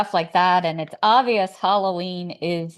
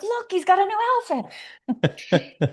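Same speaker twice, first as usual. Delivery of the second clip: much higher, playful mock-excited voice; mock delight; 12 semitones higher, faster than usual. Jogger mocking the neighbor's dressed-up skeleton, high mock delight. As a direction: squeal it like a delighted kid, tongue in cheek. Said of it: "I'm like".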